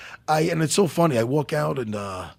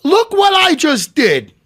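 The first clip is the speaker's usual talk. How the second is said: loud, high, drawn-out boast; mock boast; 10 semitones higher, 8 dB louder, slower than usual. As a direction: crow it high and slow. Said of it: "But in a French accent".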